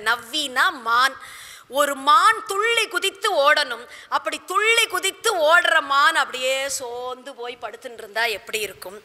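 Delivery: ordinary talk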